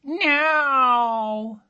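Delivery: high, flat cat's curt "No". A narrator's reading. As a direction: answer like a grumpy cat. Cat answering.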